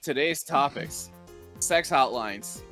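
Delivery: normal talking voice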